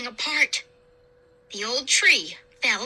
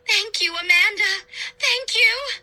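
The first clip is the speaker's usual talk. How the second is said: grateful dying cry; 8 semitones higher than usual. A narrator's reading. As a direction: cry thanks as she dissolves. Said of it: "opened her toothless mouth and cried".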